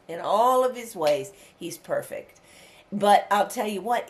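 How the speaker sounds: ordinary delivery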